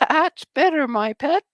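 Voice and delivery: high, loud cackling witch; hammed-up wicked witch purr; Wicked Witch of the West. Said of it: "Sound like the Wicked Witch of the West".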